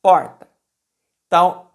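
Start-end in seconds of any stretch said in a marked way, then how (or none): 0.0-0.5 "Porta" loud on the first syllable; stressed penultimate syllable. Shown as a demonstration. Teacher confirming the tonic syllable, "Porta" with strong "Por". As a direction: punch "Por", let "ta" drop in "Porta". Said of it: "a penúltima sílaba é o por".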